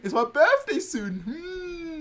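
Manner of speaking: high, loud, gushing hint; hammed-up birthday hint with a squeaky hum; hinting to grandma. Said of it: "I did the same thing to my grandma".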